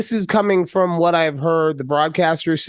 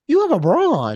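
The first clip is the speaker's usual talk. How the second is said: higher-pitched mock outrage; retold accusation; 7 semitones higher, faster than usual. Friend confronting her about the bra, mock-accusing. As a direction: accuse her in disbelief, voice lifted. Acted argument over the bra.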